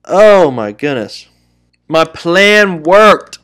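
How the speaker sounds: exasperated outburst. Real, not acted. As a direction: drag the words out in real frustration.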